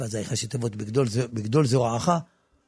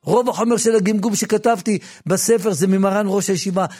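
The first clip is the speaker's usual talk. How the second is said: impassioned preaching; 8 semitones higher, 8 dB louder than usual. Acted. Preacher praising his rabbi who cured his stutter, loud. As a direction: pour out gratitude to the teacher. Emotional testimony, raised.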